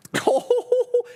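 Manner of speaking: very high, drawn-out "ooh"; excited whoop. Real, not acted. Trader celebrating a new high, excited whoop. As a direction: whoop as the price climbs. Cheering a stock hitting new highs.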